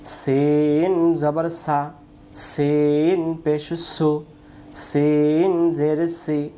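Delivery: সিন drawn out with a long hiss, each syllable slow; slow drilled reading. Shown as a demonstration. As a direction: chant the drill slowly for students. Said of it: "উচ্চারণ". (শিক্ষার্থীদের জন্য ধীরে টেনে পড়া)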